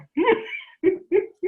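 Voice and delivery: high, loud burst of laughter. Real, not acted. Speaker asking how that would make you feel, laughing. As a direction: laugh out loud at your own question.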